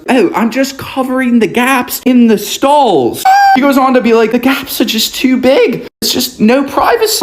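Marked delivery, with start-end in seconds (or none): 0.1-3.2 higher, light mock British accent. Retelling a bathroom stranger, light British accent imitation. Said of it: "In this British accent". 4.3-7.2 higher mock British accent, fussy. British man explaining the toilet paper, mock British accent. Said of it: "In this British accent".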